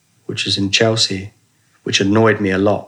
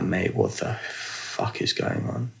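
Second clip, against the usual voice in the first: breathy, slow whispered disbelief; hushed aside, can't believe it; stunned whisper; 2 semitones lower, 10 dB quieter, slower than usual. Retold or imitated. Disbelief at the empty hospital.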